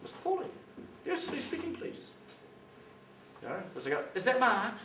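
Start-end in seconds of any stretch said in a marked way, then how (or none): 0.0-2.1 high, sugary fake-friendly phone voice; mock telemarketer sweetness; mock sweet caller voice. 4.1-4.7 higher, louder chirpy "Is that Mark?"; mock cheery phone greeting; fake friendly caller. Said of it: "Like it's some friendly person".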